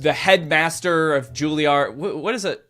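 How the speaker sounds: ordinary talk, nothing marked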